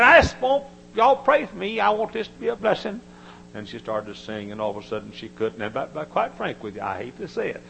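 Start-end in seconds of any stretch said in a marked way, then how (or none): 0.0-3.0 high, loud pleading woman's voice; little lady's plea. Retold or imitated. Preacher voicing a little lady asking for prayer, high and pleading. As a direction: plead high and earnest like a shy singer.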